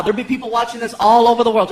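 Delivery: usual speaking voice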